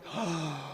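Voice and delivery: quiet gasp of wonder; soft awed gasp. Acted. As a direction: gasp softly at the sight. She sees Krishna.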